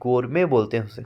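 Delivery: ordinary talk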